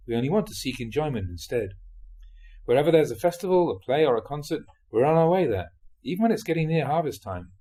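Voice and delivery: ordinary talk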